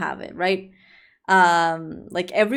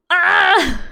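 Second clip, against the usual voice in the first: frustrated "ah" outburst, raised and loud; exasperated cry; 7 semitones higher, 5 dB louder than usual. Acted. Exasperated at dangerous hobbies.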